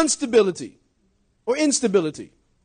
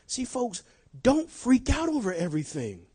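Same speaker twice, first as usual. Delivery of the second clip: ordinary delivery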